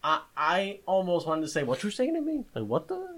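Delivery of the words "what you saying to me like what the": high-pitched, affronted mock challenge; indignant retort. Shopper nearly snapping at a stranger, indignant. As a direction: throw it back at them, pitch jumping up.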